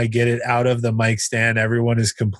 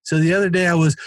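raised-pitch joke opener; stage delivery; 7 semitones higher, faster than usual. Retold or imitated. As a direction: start the bit over for the room. Comic restarts his bit onstage.

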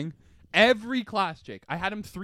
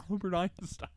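mock teacher impression; 3 semitones higher than usual. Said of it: "that was our impression of".

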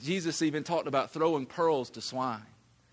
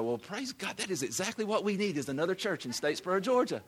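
higher, fast sarcastic cheer; mock praise; 4 semitones higher, 3 dB quieter than usual. Preacher mocks townsfolk sarcastically praising yet another church. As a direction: toss it off with dry irony. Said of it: "everybody was gonna go".

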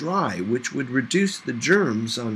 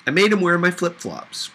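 usual speaking voice

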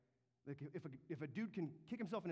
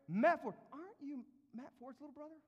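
high, loud teasing voice of a doubter; mocking onlooker; 12 semitones higher, 9 dB louder than usual. Preacher voicing a heckler who doubts him, high and loud. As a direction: needle him like a heckler from the crowd.

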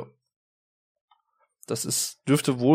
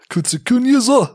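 fake foreign language; 10 semitones higher, 4 dB louder than usual. Joke about pseudo-Japanese name.

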